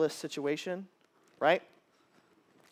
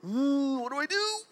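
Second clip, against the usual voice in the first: high, worried "what do I do"; mock worried; 11 semitones higher than usual. Person in a tough moment asking for help, high and anxious. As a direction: play someone lost in a hard moment. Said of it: "moments of going".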